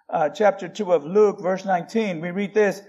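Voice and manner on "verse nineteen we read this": ordinary talk, nothing marked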